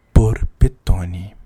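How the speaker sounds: drawn out slowly and loud; slow emphatic. Shown as a demonstration. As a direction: stretch the name out slowly. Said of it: "Meu Twitter é".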